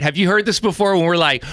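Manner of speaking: ordinary talk, nothing marked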